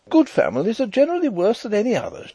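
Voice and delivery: high, quick lady's voice; tart sister-in-law. A narrator's reading. Sister-in-law slights good families, high and quick. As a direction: toss out a high, brisk retort.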